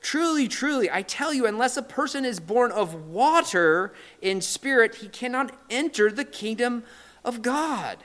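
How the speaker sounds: high, emphatic solemn statement; Jesus declaring. Retold or imitated. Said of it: "He says".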